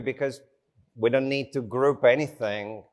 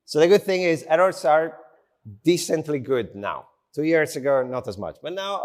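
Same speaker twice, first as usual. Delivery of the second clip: usual speaking voice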